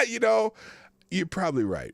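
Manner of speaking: ordinary talk, nothing marked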